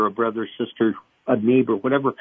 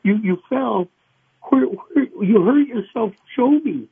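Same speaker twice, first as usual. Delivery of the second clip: worried parent; 8 semitones higher than usual. Retold or imitated. Parent soothing a hurt child.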